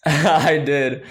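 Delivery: normal talking voice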